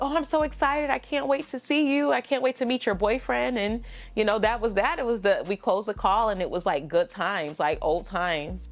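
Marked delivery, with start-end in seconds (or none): 0.0-3.6 excited. Retold or imitated. Mom excited about visit.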